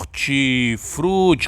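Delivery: "Hortifruti" slowly, FRU drawn out; stress on FRU. Shown as a demonstration. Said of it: "Qual é a sílaba mais forte?". ("Hortifruti" devagar, alongando o FRU)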